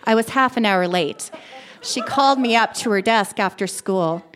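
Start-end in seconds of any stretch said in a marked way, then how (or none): none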